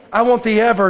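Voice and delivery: normal talking voice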